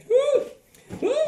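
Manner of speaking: high-pitched "woo"; short, high hoots in mock amazement; whoop. Reacting to thick Creole accent.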